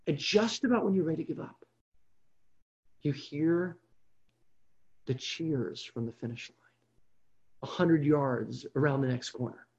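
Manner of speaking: quiet, low build-up before the turn; hushed suspense. Retold or imitated. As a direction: drop right down, as if straining to hear far-off cheers. Runner near giving up hears distant cheers.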